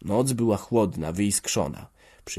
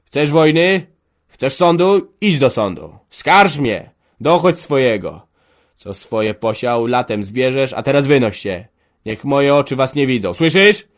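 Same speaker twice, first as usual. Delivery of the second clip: loud, much higher raging roar; 5 semitones higher, 10 dB louder than usual. A narrator's reading. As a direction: bellow at the son in fury. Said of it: "ryknął". (ryk rozwścieczonego ojca)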